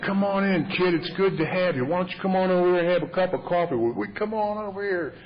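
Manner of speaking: high-pitched, effusive greeting; imitated hearty welcome. Older AA member greeting a young newcomer, imitated hearty welcome. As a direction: usher in a newcomer like an old friend. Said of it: "he just welcomed me in like he had known me".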